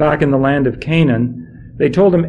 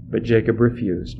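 ordinary delivery

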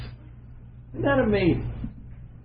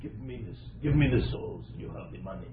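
low, put-on Eastern accent; deep mock-Eastern accent, softer delivery; fake Eastern accent; 11 semitones lower, 6 dB quieter than usual. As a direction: play Sodom's king in a fake Eastern accent. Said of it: "I gave you that fake Eastern accent".

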